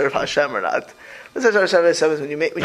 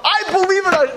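mock fervent believer; 13 semitones higher, 4 dB louder, faster than usual. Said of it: "If the Russians talk".

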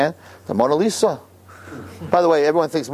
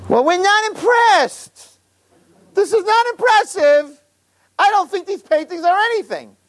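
put-on unimpressed old lady. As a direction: dismiss the art in a high yenta whine. Yentas answer the tour guide.

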